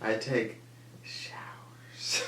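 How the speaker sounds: whispered punchline; whispering. Real, not acted. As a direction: drop to a whisper. Whispered joke.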